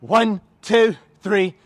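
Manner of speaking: loud drill-style cadence; shouted count. Real, not acted. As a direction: shout the numbers in steady rhythm. Trainer calls out exercise count.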